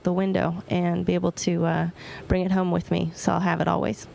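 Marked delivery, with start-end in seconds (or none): none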